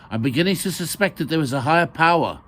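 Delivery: lightly voiced Indian accent, calling out. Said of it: "called Maya in his Indian accent".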